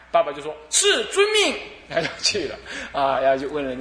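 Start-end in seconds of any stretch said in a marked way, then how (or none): 0.7-1.6 much higher eager "yes, at once"; mock obedient father. Father answering his daughter, mock servile yes. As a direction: snap to attention like a servant.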